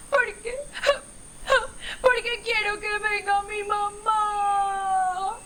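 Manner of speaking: high, slow tearful plea; sobbing calf calling for mum; crying baby elephant. Acted. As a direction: cry it out, longing for mama.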